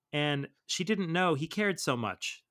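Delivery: ordinary delivery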